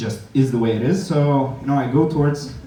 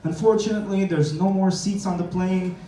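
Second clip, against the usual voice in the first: chirpy airline clerk; airline agent voice; 8 semitones higher than usual. Retold or imitated. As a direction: mimic a chirpy gate agent. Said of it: "the lady goes like".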